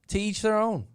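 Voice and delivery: ordinary talk, nothing marked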